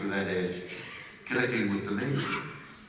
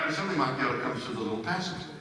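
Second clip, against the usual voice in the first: normal talking voice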